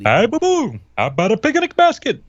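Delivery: high put-on cartoon bear; Yogi Bear voice. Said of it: "Gee, Yogi".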